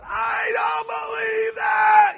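high, drawn-out imitation scream. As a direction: scream it like someone losing it. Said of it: "I mean, he's screaming".

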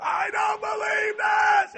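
high, loud, drawn-out mock scream. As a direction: yell it as if losing an argument. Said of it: "gr-, screaming at Joe".

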